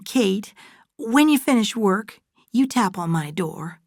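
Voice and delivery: high-pitched motherly coaxing; Fay, affectionate older mother figure. Acted.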